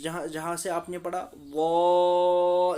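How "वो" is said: "वो" held long, louder, on one note; very long madd. Shown as a demonstration. Teacher shows how far to pull, "वो" hugely stretched. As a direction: stretch "वो" far beyond a normal vowel. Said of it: "इसको बहुत खींचना है".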